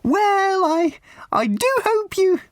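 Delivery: very high, slow, sugary raffle-lady voice; a prim, simpering old charity lady pushing tickets; high twittering church-fete lady; raffle woman. Acted.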